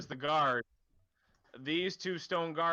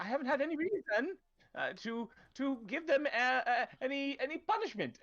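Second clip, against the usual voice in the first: higher, hesitant official's voice; nervous town official; 10 semitones higher than usual. Acted. Cornered town official stammers excuses to the knife-wielding player, high and nervous. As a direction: fumble excuses like a cornered bureaucrat.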